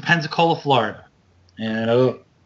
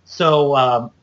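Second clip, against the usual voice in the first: usual speaking voice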